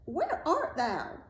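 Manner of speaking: high, slow searching call; drawn-out witch's call. A narrator's reading. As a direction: stretch the call out, pitched high. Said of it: "Then she called again".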